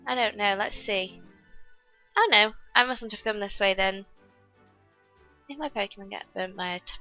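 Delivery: usual speaking voice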